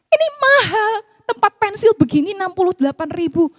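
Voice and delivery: high, loud fast mock complaint; complaining scold. Mother complaining to daughter about the price, loud mock outrage. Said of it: "Sungut saya langsung".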